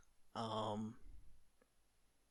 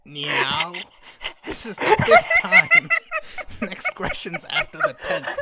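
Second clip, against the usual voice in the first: much higher, louder, cracking up mid-line; laughing sphinx voice; 18 dB louder than usual. Acted. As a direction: try to stay in character while laughing.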